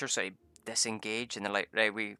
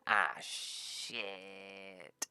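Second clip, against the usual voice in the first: softer, long falling mock groan; sinking dismay; 3 semitones lower, 7 dB quieter than usual. Party learning the Chimera is gone, sinking dismay. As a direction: let it sag away slowly and quietly.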